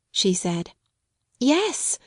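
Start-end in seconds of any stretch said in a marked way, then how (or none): 1.4-2.0 high happy yes; a glad, eager yes; joyfully. A narrator's reading. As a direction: answer with a lift of joy. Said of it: "Sarah answered joyfully".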